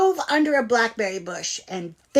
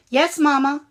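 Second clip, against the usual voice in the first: chicks answering; 7 semitones higher, 7 dB louder than usual. A narrator's reading. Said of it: "the chicks replied".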